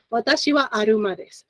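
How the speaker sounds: "aruma" said the Japanese way, with an r in place of the l; Japanese accent. Shown as a demonstration. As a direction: Japanese pronunciation of "Watashi wa aruma desu" with r for l. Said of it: "with a Japanese accent".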